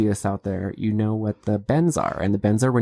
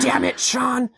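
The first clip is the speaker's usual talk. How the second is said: higher, hammed-up dramatic cry; dramatic outburst; 12 semitones higher, slower than usual. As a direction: fake TV drama exclamation. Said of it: "takes off his scuba mask dramatically and says".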